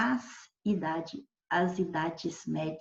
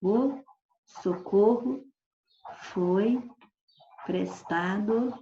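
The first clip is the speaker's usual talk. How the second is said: slow, with long pauses between words; slow statement; much slower than usual. Shown as a demonstration. Teacher slowly reads socorro used as a noun. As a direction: spell out the sentence deliberately. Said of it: "ó".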